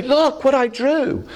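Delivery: put-on high, drawn-out kid voice; slow, high-pitched mock child boast; imitated proud child. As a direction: show off like a little kid. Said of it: "they say".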